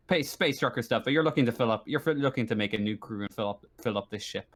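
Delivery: ordinary talk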